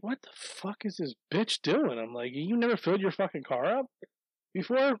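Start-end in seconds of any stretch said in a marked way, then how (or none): 0.0-2.0 incredulous. Retold or imitated. Incredulous inner rant at driver. 2.3-5.0 mocking disbelief. Mocking the clueless driver.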